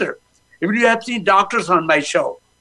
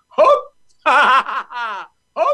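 laughing pill demo; 4 semitones higher than usual. Shown as a demonstration. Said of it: "take a laughing pill".

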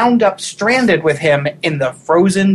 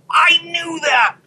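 whispered shout; 10 semitones higher, slower than usual. Retold or imitated. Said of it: "you're gonna be like".

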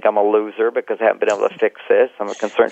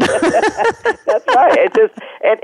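laughing; much slower than usual. Real, not acted. Laughing agreement.

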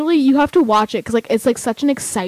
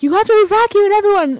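high, loud urgent order, imitating the guy; urgent warning; 8 semitones higher, 5 dB louder than usual. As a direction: bark the evacuation order in mock alarm. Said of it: "And the guy's like".

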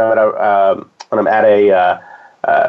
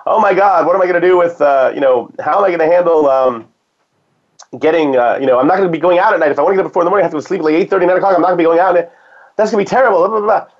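higher-pitched mock panic of a past self; freaking out; 6 semitones higher, faster than usual. Guest recalls fretting over early bedtimes in year one, freaking out. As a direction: imitate your own worried freak-out, higher voice. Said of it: "I'm freaking out".

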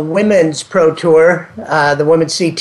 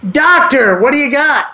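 calling out; 8 semitones higher than usual. Real, not acted. Host calling out to co-host.

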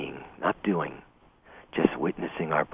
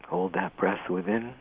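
guided meditation. Acted. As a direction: ease the listener into calm, unhurried. Leads a guided breathing meditation.

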